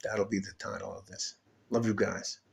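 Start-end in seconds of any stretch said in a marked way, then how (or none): none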